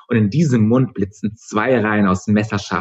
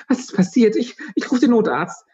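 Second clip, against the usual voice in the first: much higher, frightened friend; alarmed friend. A narrator's reading. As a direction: panic over a hurt friend. Aggie finds Mitra hurt.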